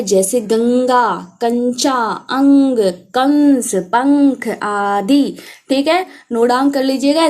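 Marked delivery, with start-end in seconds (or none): 0.4-4.5 slow, with गंगा, कंचा, अंग, कंस, पंख each nasalised; nasal sound shown. Shown as a demonstration. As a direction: draw each word out through the nose. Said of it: "नाक से ध्वनि निकलती है". (गंगा, कंचा, अंग, कंस, पंख धीरे-धीरे, नाक से)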